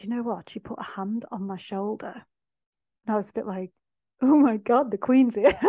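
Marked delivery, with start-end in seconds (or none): 4.2-5.6 louder, higher, playful alarm; mock starstruck panic. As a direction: exclaim in giddy mock shock. Retold reaction to Queen's visit.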